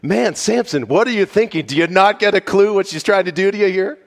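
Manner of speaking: high, loud and fast disbelief; preacher venting his own frustration at Samson; exasperated outburst. Retold or imitated. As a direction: shout at him to wake up. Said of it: "I'm thinking".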